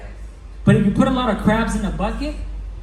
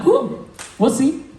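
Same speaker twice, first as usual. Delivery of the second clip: slower, higher, cocky and unbothered; a lightly voiced smug recruiter; smug brush-off; 5 semitones higher, slower than usual. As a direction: shrug it off like you know better. [name]'s smug reply to challenge.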